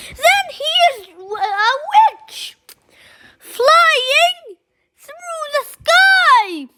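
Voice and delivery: high and loud, bursting with excitement; excited storytelling. A narrator's reading. Child announcing a flying witch, high and loud. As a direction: call it out like the best news ever.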